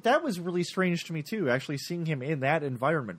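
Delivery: ordinary delivery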